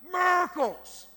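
excited holler, high and loud. Retold or imitated. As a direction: shout it out like calling across a field. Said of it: "he was hollering something".

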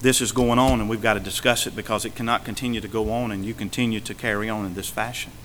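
soft, fast, private word to a brother; gentle confrontation. Retold or imitated. Pastor privately confronting a sinning church member, soft and quick. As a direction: quietly tell him it can't go on. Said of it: "to go to somebody and say".

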